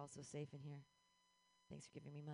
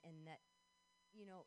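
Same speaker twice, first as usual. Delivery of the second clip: normal talking voice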